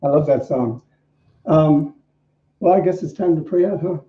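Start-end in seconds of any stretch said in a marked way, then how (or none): none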